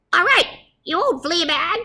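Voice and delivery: high, squeaky little mouse voice; squeaky mouse commander. Acted. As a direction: squeak it like a tiny brave officer. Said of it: "squeaked the Big Cheese".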